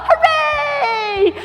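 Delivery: high, drawn-out hooray; gleeful high shout; jubilant cheer. Acted. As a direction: cheer like a delighted puppet. Celebrates giant's defeat.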